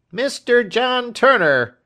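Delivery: very high, loud announcement; crying out. A narrator's reading. Hotel waiter announcing a visitor, crying out. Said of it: "cried the hotel waiter".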